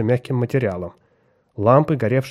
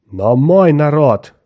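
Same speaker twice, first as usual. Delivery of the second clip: loud, slow, booming protest; forceful captain's roar; thundering; 2 semitones higher, 8 dB louder, much slower than usual. A narrator's reading. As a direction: thunder it, drawing out each word. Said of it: "гремел капитан".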